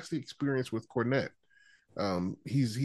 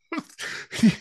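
forced laugh; 10 semitones higher than usual. Retold or imitated. Host mocks the way Brian laughs, forced laugh. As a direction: imitate a laugh that is pushed out. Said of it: "he forces it".

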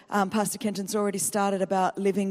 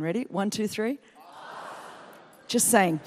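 ordinary talk, nothing marked

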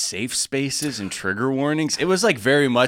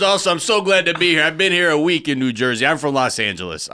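put-on Jersey guy accent; 3 semitones higher than usual. Comedian reading the host's opening monologue in Jersey accent. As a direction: ham up a New Jersey host. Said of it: "I don't know why I'm doing this accent".

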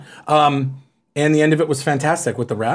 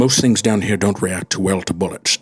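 celebrity impression; 9 semitones lower than usual. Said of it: "what's your best celebrity impression".

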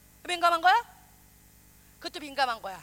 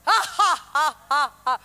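slow dreamy laugh; 9 dB louder, much slower than usual. Pretend. Acted lover's swoon laugh.

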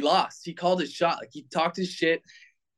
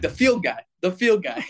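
higher, rising disbelief on the repeat; incredulous; 5 semitones higher, 3 dB louder than usual. Retold or imitated. Player retelling his reaction to the field guy's boast, incredulous. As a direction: repeat it as if you can't believe who said it.

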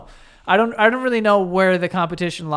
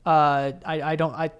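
ordinary delivery

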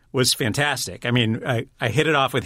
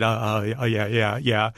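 small, halting starstruck mumble; nervous stammer; 2 semitones lower than usual. Retold or imitated. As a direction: imitate a tongue-tied, nervous reply. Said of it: "I was so nervous".

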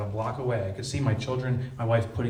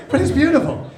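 loud and high, still laughing; laughing irony; 12 dB louder than usual. Real, not acted. Speaker to audience, ironic callback while still laughing. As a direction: toss it back through the laughter.

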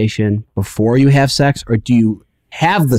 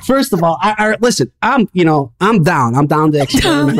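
higher-pitched, flustered protest; hot and bothered; 5 semitones higher than usual. Real, not acted. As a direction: stumble through it, flustered and restarting. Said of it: "He is so hot and bothered".

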